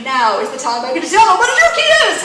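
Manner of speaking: high, loud, rushed mock fuming; retold indignation, fast and loud; worked-up resolve. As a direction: burst out as if about to unload. Retells her fuming inner resolve.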